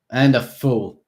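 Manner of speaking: 1 pressing insult. A narrator's reading. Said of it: "I pressed on".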